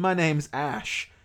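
slow, deliberate self-introduction. Retold or imitated. Showing a boring name example, said slowly. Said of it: "it wouldn't be funny if you said".

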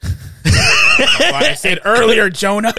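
high, loud, drawn-out mock scolding; exasperated comic shout; laughing yell. Real, not acted. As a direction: holler it through laughter. Mock scolding co-host amid laughter.

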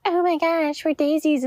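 high-pitched imitation of boastful girls; mock gushing. Envious friend imitating the Daisy girls, mock gushing. Mimicking the girls she envied.

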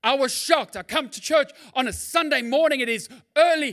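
loud, driving sermon build; full-force preaching. Acted. Pastor preaching at full force to the congregation.

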